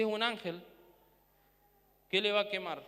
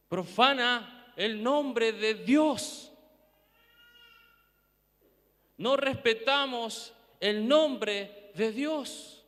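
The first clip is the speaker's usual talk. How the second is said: slow emphatic; 3 semitones higher, 4 dB louder, much slower than usual. Real, not acted. Slow emphatic sermon point.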